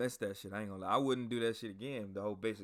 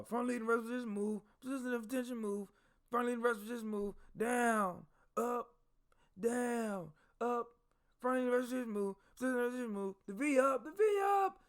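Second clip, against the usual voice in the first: barked drill commands; 12 semitones higher than usual. Retold or imitated. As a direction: drill sergeant barking exercises at recruits. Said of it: "One drill sergeant was like".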